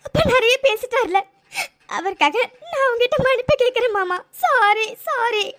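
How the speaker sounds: weep as you say sorry for your father; crying apology. Acted. Girl to her uncle, apologizing for her father's insults, crying.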